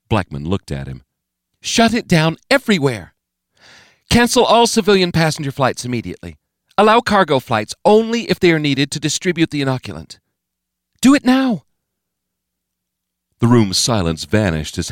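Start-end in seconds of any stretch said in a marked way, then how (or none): 1.6-11.7 higher, louder, clipped-free firm orders; the President ordering flights shut down at once; commanding President. A narrator's reading. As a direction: give orders that brook no delay.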